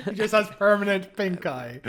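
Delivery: giggling through the joke; laughing. Real, not acted. Cohost joking about Davros's closed eyes, laughing through it. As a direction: laugh while you deliver the punchline.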